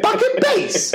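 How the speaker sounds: very high, laughing disbelief; incredulous echo. Retold or imitated. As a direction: throw the phrase back in mock disbelief. Incredulous reply to soldier talk.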